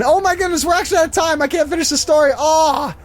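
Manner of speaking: high-pitched, hammed-up dismay; mock panic. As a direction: pretend time just ran out, wailing it playfully. Fake sign-off, then admits joking.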